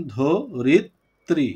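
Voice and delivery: "ধো রিত ত্রি" in three separated beats; syllable breakdown. Shown as a demonstration. Teacher showing three syllables in a word, "ধো রিত ত্রি" said apart. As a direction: count out "ধো রিত ত্রি" piece by piece. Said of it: "তিনটা অক্ষর এখানে".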